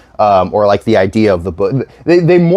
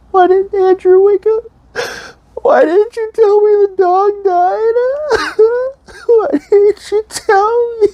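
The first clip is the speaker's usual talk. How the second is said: much higher, sobbing, exaggerated crying girlfriend; slower than usual. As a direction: wail it out like a heartbroken girlfriend, slow and high. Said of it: "doing that crying voice".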